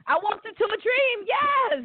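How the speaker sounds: high, thrilled exclamation; ecstatic, reliving a dream night; elated. Real, not acted. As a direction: pitch it way up, overjoyed. Reliving the best night ever.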